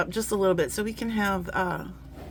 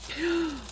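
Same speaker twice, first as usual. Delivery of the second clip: a sharp delighted gasp; 8 semitones higher, 6 dB quieter than usual. Real, not acted. Opening the first door, a delighted gasp. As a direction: suck in air, amazed.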